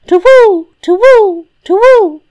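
loud, high hoot, falling at the end; piercing storybook owl call; screech owl cry. Acted. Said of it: "A screech owl with glowing eyes".

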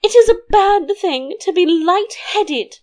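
high-pitched queen voice; queen's answer. A narrator's reading. Queen contradicts the king, high upset voice. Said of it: "answered the queen".

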